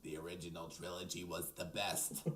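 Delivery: put-on raspy old woman voice; old smoker voice. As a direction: talk like a sixty-year-old smoker. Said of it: "Why do you sound like a smoker".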